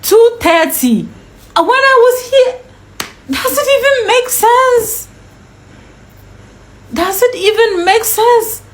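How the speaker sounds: high-pitched, disbelieving protest; exasperated, stretching the repeated question; incredulous outrage. Retold or imitated. Outraged at being told to wait.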